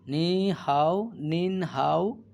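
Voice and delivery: "নি হাও", "নিন হাও" drawn out slowly, syllable by syllable; slow careful pronunciation drill; slow syllable demo. Shown as a demonstration. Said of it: "নিমেন হাও।".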